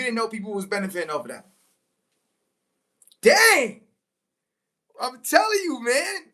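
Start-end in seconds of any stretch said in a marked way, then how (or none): 3.2-3.8 excited exclamation. Real, not acted. Hyped by own words.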